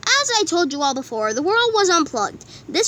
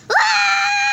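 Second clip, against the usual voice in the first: sudden high excited shriek; 3 dB louder than usual. Acted. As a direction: shriek with joy. Excited about letters.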